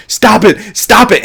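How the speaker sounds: imitating a sobbing plea to stop; retold crying shout. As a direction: shout it like someone breaking down in tears. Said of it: "and she's crying".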